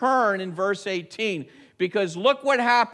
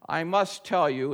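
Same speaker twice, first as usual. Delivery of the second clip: usual speaking voice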